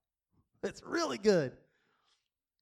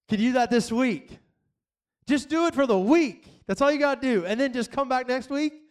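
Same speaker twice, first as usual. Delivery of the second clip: loud, high, rousing pulpit call; full-force preaching; 4 semitones higher, 5 dB louder than usual. Acted. Pastor urging his church on, loud and rising. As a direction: lift the congregation up, loud and high.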